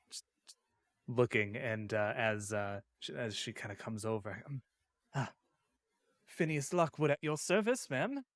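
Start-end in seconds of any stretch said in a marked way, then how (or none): 5.1-8.2 courtly, rising self-introduction; bow and present yourself like a gentleman; gallant gentleman. Acted. Player character introducing himself to the hostess, courtly.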